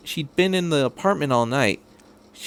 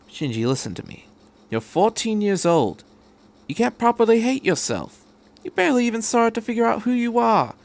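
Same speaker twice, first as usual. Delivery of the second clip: gentle but firm talking-to; lightly voiced doctor; 9 semitones higher than usual. Doctor telling the fourteen-year-old to stop hating himself.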